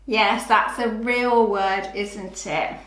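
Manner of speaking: normal talking voice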